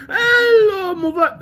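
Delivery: high, cry-like mock hello to mother; mock high greeting. To his mother as she comes in, a high mocking hello.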